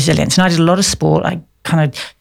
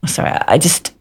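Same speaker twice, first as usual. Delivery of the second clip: mock apologetic; 8 semitones higher, slower than usual. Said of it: "don't lean into that".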